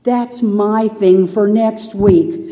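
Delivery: slowed wait a minute dawning alarm; mock dismay; slow realization. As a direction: draw it out as it dawns. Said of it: "I went".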